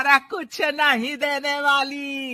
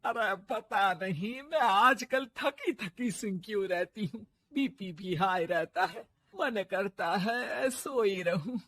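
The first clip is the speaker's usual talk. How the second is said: softer, tired complaint; weary; 2 semitones lower, 8 dB quieter than usual. Acted. Tired mother-in-law complains of exhaustion, soft. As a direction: sound worn out and drained.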